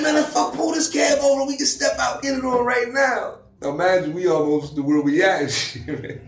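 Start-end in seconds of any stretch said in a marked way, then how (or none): 0.0-3.4 high, loud retold fighting talk; mock challenge. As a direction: challenge him to step out. Friend challenges driver to fight.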